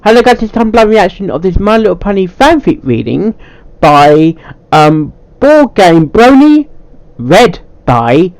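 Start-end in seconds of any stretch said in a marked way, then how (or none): 0.0-3.3 higher, brighter YouTube greeting; cheery channel intro; upbeat intro voice. Acted. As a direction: sell the opening like a host. Intro take.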